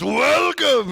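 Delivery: loud, high arena announcer call; announcer welcome. Retold or imitated. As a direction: shout it like a stage host. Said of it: "Adonis goes".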